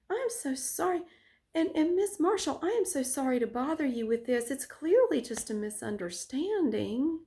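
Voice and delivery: high, syrupy fake apology; angelic innocent act. Teacher apologizing to the student, high and syrupy. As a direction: pour on sweet false sorrow. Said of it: "so angelic".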